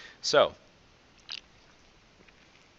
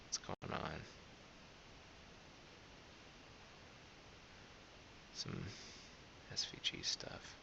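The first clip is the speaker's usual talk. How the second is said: whispering; 5 semitones lower, 20 dB quieter than usual. Real, not acted. Muttering to himself while debugging.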